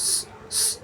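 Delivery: স- as a long unvoiced hiss with no voice. Shown as a demonstration. Teacher shows students the sound স-, a sharp unvoiced hiss. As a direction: hiss স- out through the teeth like hot oil. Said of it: "এভাবে আওয়াজ হবে".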